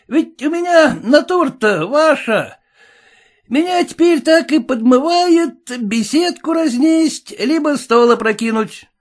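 high, reckless swagger; rowdy son. Acted. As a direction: sound itching to smash things.

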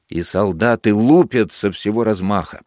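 ordinary delivery, nothing marked

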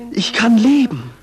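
triumphant cry. Acted. Quoting mankind's triumphant cry.

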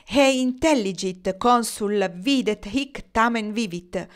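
high, slow Latin oration; impassioned orator's delivery; declaimed monologue. A narrator's reading. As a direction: declaim it grandly, word by word. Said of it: "continuò il suo monologo".

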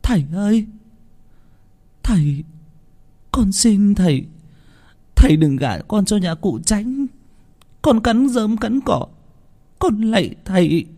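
tearful begging. A narrator's reading. Daughter kneeling before her father, tearful begging not to be married off. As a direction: beg desperately, slowing on the vow. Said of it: "khẩn thiết van xin từng lời trong nước mắt".

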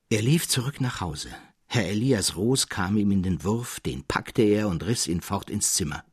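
usual speaking voice

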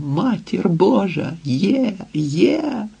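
high, hushed exclamation of discovery; excited cry. A narrator's reading. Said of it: "кричав". (стишений захоплений вигук)

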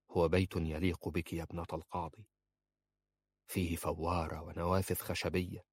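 soft, voiced murmur; whisper. A narrator's reading. Man describes their house to her, in a soft near-whisper. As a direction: describe it quietly, lost to the past. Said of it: "همس وكأنه نسي كل الماضي".

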